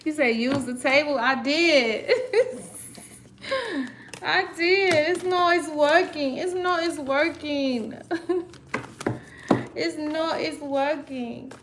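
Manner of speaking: high, laughing protest; laughing frustration. Real, not acted. Answering viewers about stuck cards, laughing protest. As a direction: giggle through the struggle.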